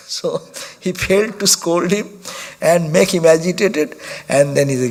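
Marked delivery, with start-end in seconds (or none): none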